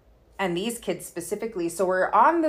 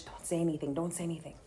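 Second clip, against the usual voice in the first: much softer, urgent hush; hushed warning; 3 semitones lower, 11 dB quieter, faster than usual. Retold or imitated. Friend Pam quietly urging her on the bus, hushed. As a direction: murmur it so the bullies won't hear.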